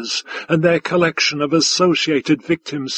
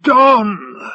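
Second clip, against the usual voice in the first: high, loud one-word roar; explosive high cry; 6 semitones higher, 5 dB louder than usual. A narrator's reading. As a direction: bellow it to seal the bet. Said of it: "the affronter roared".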